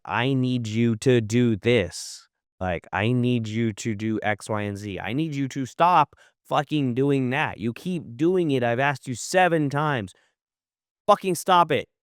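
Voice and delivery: slow, emphatic, exasperated demands; firm scolding. Acted. Boss telling off someone asked seven times, firm scolding. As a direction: lay down the order word by word. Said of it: "you just gotta yell at people".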